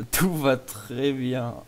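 very slow, stretched-out words; exaggeratedly slow delivery; drawn-out reassurance. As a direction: drag each word out as if all is fine. Feigns being fine in bonus clip.

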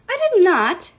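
high, loud denial; indignant yell. A narrator's reading. Iris denying hitting a sibling, indignant yell. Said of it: "yelled Iris".

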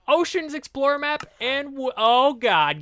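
high, loud burst of joy; excited shriek at the unlock; thrilled. Real, not acted. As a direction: yelp it out in disbelief. Rare map unlocked.

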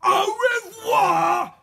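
very high, loud, drawn-out gloating farewell; shout. A narrator's reading. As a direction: bellow a long triumphant goodbye. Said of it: "shouted Mister Gum".

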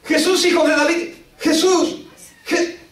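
high, repeated cry, cut off; imitated shouting. Woman goes on calling as Jesus approaches, imitated shouting. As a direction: keep crying his name, high and insistent. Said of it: "ella sigue gritando".

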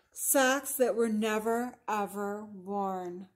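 slow, deliberate storybook list; unhurried picture-book narration; story reading to children. A narrator's reading. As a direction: linger on each item for young listeners. Reads picture book aloud.

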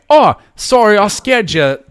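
a cheery Australian accent, badly attempted; clumsy Australian accent apologizing to a stingray; Steve Irwin. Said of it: "I don't know how to do an Australian accent".

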